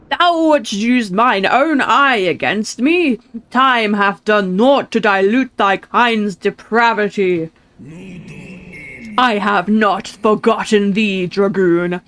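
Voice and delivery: slow, loud grandiose villain voice; dramatic villain reading. Acted. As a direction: declaim like an ancient dragon. Reads boss dialogue aloud.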